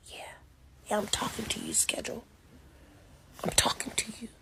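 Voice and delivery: hushed, menacing whisper at the schedule; defiant whisper aimed at a schedule; whispered threat. Acted. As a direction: whisper it like a private warning.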